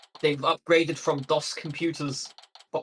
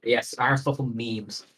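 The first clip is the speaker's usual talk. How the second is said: ordinary talk, nothing marked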